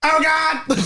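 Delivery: high, loud comic wail; mock anguished cry. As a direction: blurt it like an overdone actor. Blurts the actor's infamous line.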